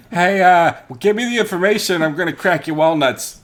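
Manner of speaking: failed-persuasion player turning to cartoonish threats; mock thug threat. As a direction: bellow like a cartoon goon. Mock thug after failed roll.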